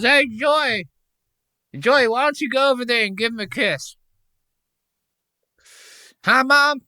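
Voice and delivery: hammed-up host egging his mom on; Tony calling his mom. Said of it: "He goes".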